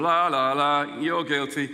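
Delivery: mock the arrogant preacher; mock arrogant vicar. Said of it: "this arrogant vicar".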